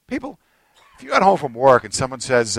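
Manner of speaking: ordinary talk, nothing marked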